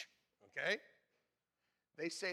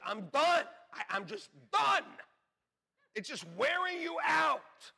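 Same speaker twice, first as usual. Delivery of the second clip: higher, louder fed-up cry; exasperated outburst; 10 semitones higher, 8 dB louder than usual. Retold or imitated. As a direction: throw up your hands, finished. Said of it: "And then you're like".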